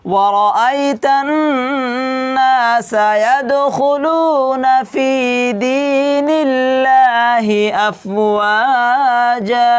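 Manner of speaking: very slow melodic recitation; Quran recitation. Shown as a demonstration. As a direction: chant the verse slowly as a model for students. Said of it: "এরকম করে কি করতে হবে? পড়তে হবে". (ধীর সুরেলা তিলাওয়াত)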